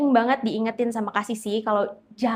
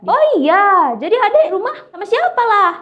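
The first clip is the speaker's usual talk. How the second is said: much higher and louder, bright reply; lively chatter; 10 semitones higher, 8 dB louder than usual. Retold or imitated. Bang Jack asking the passenger about her family, high and lively. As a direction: keep the talk going cheerfully.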